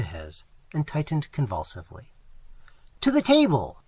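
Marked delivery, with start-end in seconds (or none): 3.0-3.8 loud, much higher call; shouted. A narrator's reading. Fallowby shouting his hungry friends to dinner. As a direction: summon everyone to the table with gusto. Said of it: "shouted Fallowby".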